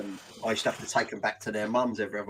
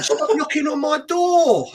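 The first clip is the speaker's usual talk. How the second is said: high, slow, shouted warning at the door; angry yell; 6 dB louder than usual. Retold or imitated. Fed-up neighbour yelling at the cat finder, shouting.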